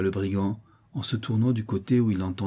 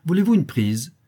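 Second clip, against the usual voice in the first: high, loud impish question; gleeful high-pitched taunt; mocking offer; 9 semitones higher, 8 dB louder than usual. A narrator's reading. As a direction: tease him in a shrieky boy's pitch. Boy keeps mocking the brigand.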